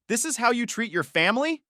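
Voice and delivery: high-pitched, outraged question; indignant question. Acted. Father's parting outrage at the arrest.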